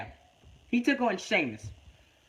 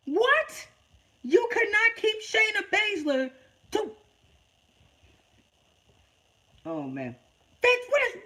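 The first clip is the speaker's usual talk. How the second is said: high-pitched disbelieving outburst at Vince; real fan outrage at the booking, voice pitched up; exasperated rant; 11 semitones higher than usual. Real, not acted. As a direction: throw your hands up in outraged disbelief. Ranting at WWE booking.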